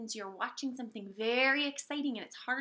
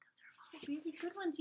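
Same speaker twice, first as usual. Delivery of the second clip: whisper; 8 dB quieter, faster than usual. Real, not acted. Teacher praising quietly.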